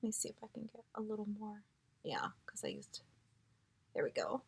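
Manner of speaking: fast, soft whisper to herself; whispered muttering. Real, not acted. Swatching on her arm, quickly whispering to herself. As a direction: mutter it under your breath.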